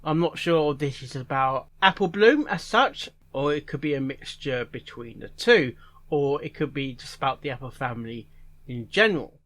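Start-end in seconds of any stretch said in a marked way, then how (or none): none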